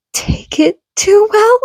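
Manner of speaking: high, incredulous question; disbelieving echo. Acted. Mother hearing her son was hurt, incredulous. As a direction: repeat his words, not believing them.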